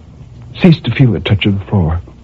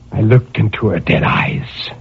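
usual speaking voice

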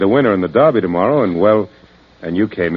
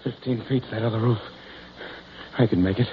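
much softer, hushed calculation; tense self-talk; 11 dB quieter, faster than usual. Acted. Fugitive facing the gap, tense and hushed. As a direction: talk yourself into the leap.

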